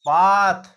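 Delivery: पाथ said slowly and loudly as paath, long aa; clear word demo. Shown as a demonstration. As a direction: stretch the aa in पाथ. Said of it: "एक आवाज बता रहा हूं".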